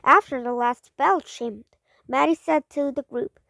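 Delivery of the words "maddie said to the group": usual speaking voice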